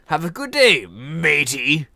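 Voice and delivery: imitated loud goodbye, "matey" punched; hard stress on matey. Departing man's confusing goodbye, "matey" hit like a slur. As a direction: land hard on "matey" as if insulting. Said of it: "hard just emphasis on matey".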